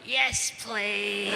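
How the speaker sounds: high feeble plea; pathetic plea. Retold or imitated. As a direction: beg weakly, high. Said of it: "I went".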